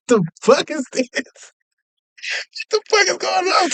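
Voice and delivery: high incredulous what-the-hell, voice climbing up; mock bewildered laughter. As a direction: act out friends baffled and amused. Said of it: "they bust out laughing".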